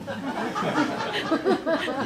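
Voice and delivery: sudden high laughter. Real, not acted. Laughing at the puppy's garlicky breath. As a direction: laugh at the joke.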